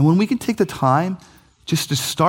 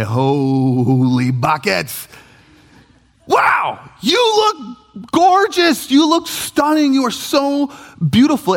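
awed compliment; 9 semitones higher, much slower than usual. Retold or imitated. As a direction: gush over your spouse's dress. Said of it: "and you say".

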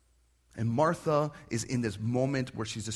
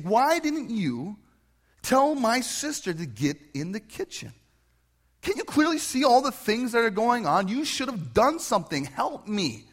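higher, louder complaining accusation; accusing; 8 semitones higher, 5 dB louder than usual. Retold or imitated. Martha accusing Jesus, high and loud with complaint. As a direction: whine the blame at him. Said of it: "she accuses Jesus".

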